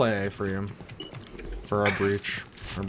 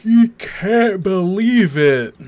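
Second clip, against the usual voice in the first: mocking echo; 9 semitones higher, 9 dB louder, slower than usual. Real, not acted. Mocking teammate's disbelief.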